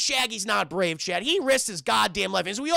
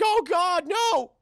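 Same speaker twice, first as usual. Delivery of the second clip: high mock-panicked cartoon cry; Shaggy; 8 semitones higher, 3 dB louder, slower than usual. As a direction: wail as the scared sidekick going off the road. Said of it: "he's fucking panicking".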